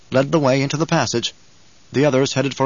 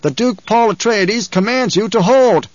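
slow, high, proclaimed order; raised, stately shout, drawn out; shouted command; 8 semitones higher, 4 dB louder, slower than usual. A narrator's reading. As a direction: proclaim a duke's command over a battle. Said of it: "Paul shouted".

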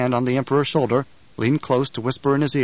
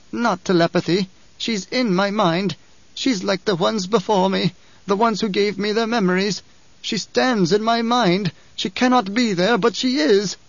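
put-on higher, slow voice of a shaken old woman; frightened old truth-sayer; 8 semitones higher than usual. A narrator's reading. Truth-sayer explaining to the Emperor, lightly voiced fear. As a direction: let the dread grow line by line.

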